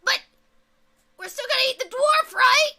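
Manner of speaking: high, loud and fast hopeful question; high eager goblin. Acted. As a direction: ask hungrily in a high goblin voice.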